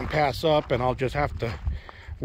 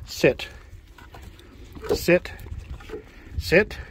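firm dog commands; 3 semitones higher, much slower than usual. Real, not acted.

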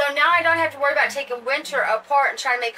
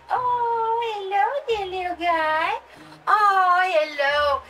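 put-on higher baby-talk greeting; cooing hello; 6 semitones higher than usual. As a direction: coo at the little doll. Greeting the next doll.

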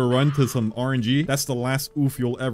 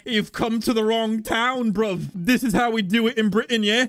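hammed-up British tough-guy accent, higher; mock British accent; 6 semitones higher than usual. Streamer restores chat's immersion with a mock British accent. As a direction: play a cocky British geezer accent. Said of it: "Now I'm proper British".

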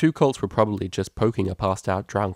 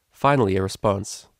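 usual speaking voice

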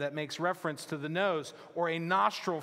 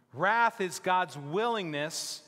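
louder, higher, slow and emphatic sermon delivery; fervent preaching; 5 semitones higher, 5 dB louder than usual. Acted. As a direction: build the point with mounting conviction. Preaching climax with rising force.

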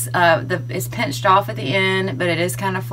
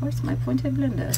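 playful put-on English accent, higher; 6 semitones higher, 3 dB quieter than usual. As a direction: ask it like a posh Brit, in an English accent. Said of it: "That's my English accent".

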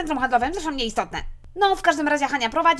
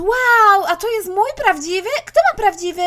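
amazed; 3 semitones higher, 6 dB louder than usual. Acted. Mother amazed at cake.

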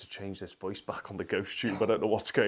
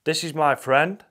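loud; 5 semitones higher, 9 dB louder, slower than usual. Real, not acted. Answering the spirit box loudly.

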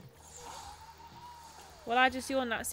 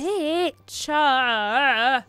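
loud higher curse at the enemy; sudden outburst; 3 semitones higher, 11 dB louder, much slower than usual. Real, not acted. Gamer cursing a monster, loud angry outburst. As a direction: spit it out at the monster.

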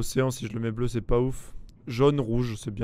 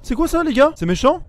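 surprised; 13 semitones higher, 5 dB louder than usual. Real, not acted. New creature appears.

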